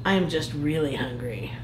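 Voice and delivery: low, soft, sly admission; sly Crabby. Acted. Crabby teases a nervous Plankton, low and sly. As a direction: drop low and quiet for the sly twist.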